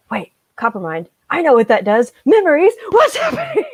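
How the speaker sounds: building from puzzled to a high, loud squeal; rising excitement. Retold or imitated. Fan recalling the copper mine reveal, building to a squeal. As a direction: climb into gleeful shock. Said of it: "I was like".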